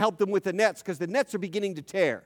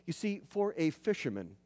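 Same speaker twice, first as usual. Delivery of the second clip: normal talking voice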